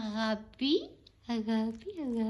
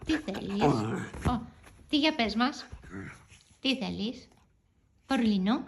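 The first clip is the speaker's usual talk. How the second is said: normal talking voice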